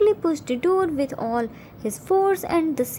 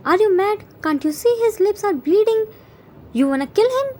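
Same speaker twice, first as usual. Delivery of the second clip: raised, higher-pitched outraged questions; angry confrontation; 6 semitones higher, 4 dB louder, faster than usual. A narrator's reading. Yoongi demanding whether professor wants to kill, angry. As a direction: challenge him in disbelief, voice climbing.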